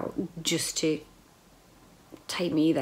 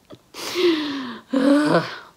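loud laugh; 8 semitones higher, 7 dB louder than usual. Real, not acted. Laughing at own tiredness.

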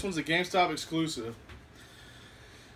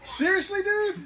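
surprised; 5 dB louder than usual. Real, not acted. Excited about figure.

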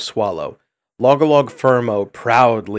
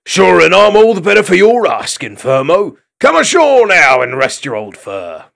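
loud, hearty Irish-lilt welcome; an old hedgehog chief welcoming friends ashore; Irish-lilt dune hog chief; 7 semitones higher, 8 dB louder than usual. A narrator's reading.

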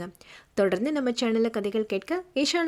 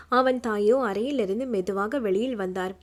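ordinary delivery, nothing marked

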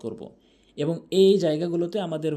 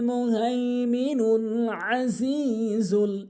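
slow, sustained chanting with long-held vowels; Quran recitation; 5 semitones higher, much slower than usual. Shown as a demonstration. Teacher opens the Quran lesson with a verse, slow melodic recitation. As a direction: recite it slowly and melodically, stretching each elongation.

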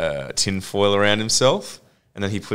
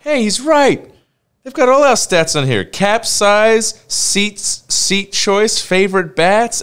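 high, loud, excited quote; 11 semitones higher, 5 dB louder, slower than usual. Retold or imitated. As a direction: blurt it out, much higher and louder. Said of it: "it's just like".

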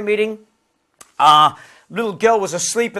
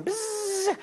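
high, soft electric buzz; window motor buzz; 12 semitones higher, 12 dB quieter than usual. Retold or imitated. Man mimicking BMW driver's window, high soft buzz. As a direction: imitate a window motor whirring.

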